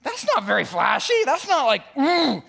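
loud, high, incredulous protest; disappointed pastors. Retold or imitated. Pastors balking at shoveling walks, incredulous. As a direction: protest that it's not flashy. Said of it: "The pastors were like".

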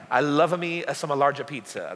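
playful, hammed-up Italian accent; mock Italian accent. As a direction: add Italian vowels between the words, jokingly. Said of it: "That's my Italian accent".

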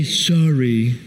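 low, careless apology; insincere low sorry. Retold or imitated. A spouse brushing off a partner with a low, empty sorry. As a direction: toss off a sorry you don't mean.

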